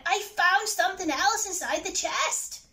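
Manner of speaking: high-pitched, urgent announcement; urgent cry. A narrator's reading. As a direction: call out the discovery urgently. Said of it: "she cried".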